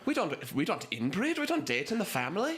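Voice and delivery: high, lightly French scolding; French accent. Acted. Frenchman scolding Seamus about dating cousins, high light French accent. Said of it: "and I'll do French".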